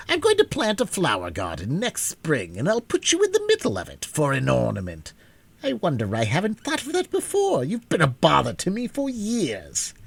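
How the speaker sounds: put-on a stern old witch, pleased with her plan; lightly voiced old witch, gloating; old witch Mombi. A narrator's reading. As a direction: voice the witch cold and self-satisfied.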